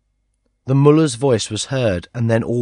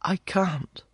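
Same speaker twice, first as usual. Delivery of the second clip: put-on girl's soft refusal; 8 semitones higher, 7 dB quieter than usual. A narrator's reading. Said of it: "as much as to say".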